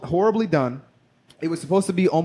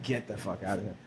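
stunned mutter; 8 semitones lower, 12 dB quieter than usual. Retold or imitated. Disbelief at stroke news.

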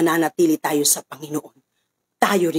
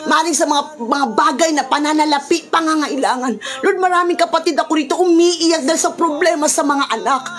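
prayer; 9 semitones higher than usual. Acted. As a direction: pray aloud for the whole congregation, rising in fervour. Pastor continues prayer over music. (taimtim na panalangin na lumalakas at tumataas)

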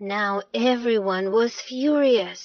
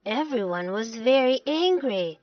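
usual speaking voice

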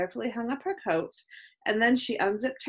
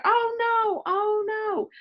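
high-pitched panicked cry; dismayed; 12 semitones higher, 4 dB louder, slower than usual. A narrator's reading. Chloe spotting her sweater on floor, dismayed.

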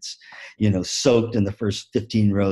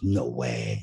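much lower, softer disbelieving voice; doubtful young whale; 12 semitones lower, 6 dB quieter than usual. Acted. New Shamu answers old Shamu, low and doubting. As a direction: scoff in disbelief.

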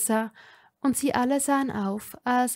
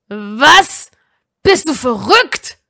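much louder, stunned angry yell; shouting; 3 semitones higher, 14 dB louder, slower than usual. A narrator's reading. Draco yelling at Ron over the accusation. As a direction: yell it in disbelief. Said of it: "schrie Draco".

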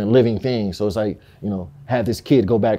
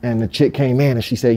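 ordinary talk

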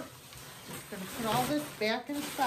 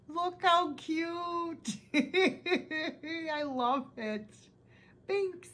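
delighted squeal; 7 semitones higher, much slower than usual. Real, not acted. Delighted by gift.

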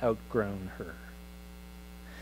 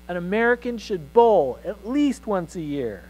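higher and louder, a grand mock proclamation; pompous boy; 7 semitones higher, 6 dB louder than usual. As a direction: declare it like a young patriot laying down law. Boy declares manifesto to mother.

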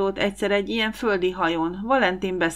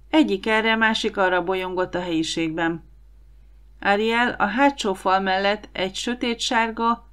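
usual speaking voice

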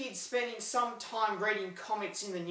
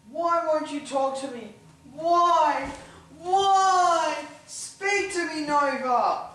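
building from a loud plea to a much louder, higher shout; desperate yelling at dog; 6 semitones higher, 9 dB louder, slower than usual. Acted. Yelling at his dog Nova to talk, frantic and loud. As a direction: beg the dog to speak, rising into a frantic higher yell.

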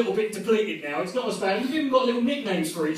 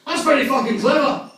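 raised, challenging Scottish heckler voice; loud, higher-pitched mock Scotsman; big gruff-mannered Scotsman; 5 semitones higher, 6 dB louder than usual. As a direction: call it out from the front row as a huge Scot. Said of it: "the biggest Scotsman in the world".

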